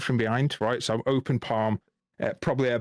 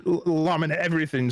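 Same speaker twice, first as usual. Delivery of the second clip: normal talking voice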